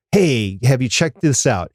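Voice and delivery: ordinary delivery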